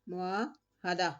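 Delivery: मुआहदा drawn out slowly, "ha-da" with zabar; slow correct pronunciation. Shown as a demonstration. Teacher slowly models मुआहदा for students. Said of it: "नहीं है, मुआहदा है".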